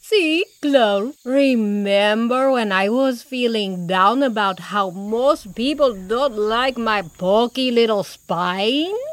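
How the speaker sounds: slow, drawn-out character voice; jolly porcupine. A narrator's reading. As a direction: reminisce fondly in a porcupine voice. Porco reminisces in character voice.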